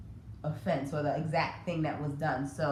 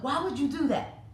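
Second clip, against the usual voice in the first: higher, sharp exasperated scolding; scolding parent; 8 semitones higher than usual. Retold or imitated. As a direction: imitate a parent getting on their child. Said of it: "really get on their child".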